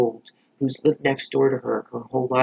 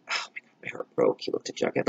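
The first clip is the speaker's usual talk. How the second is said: hushed whisper, deeply moved; quiet whisper over a sad scene; heartbroken whisper; 13 semitones lower, 5 dB quieter than usual. Real, not acted. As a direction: let the voice drop to a whisper. Heartbroken recalling the arrest scene.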